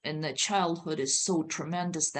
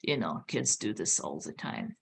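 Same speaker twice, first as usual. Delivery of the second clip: dismissive listener; 11 semitones lower than usual. Retold or imitated. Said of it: "you're probably going like".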